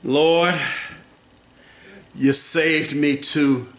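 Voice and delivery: low, slow devotional murmur; hushed prayerful preaching. Real, not acted. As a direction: bring it down to an intimate prayer. Quiet slow worship after climax.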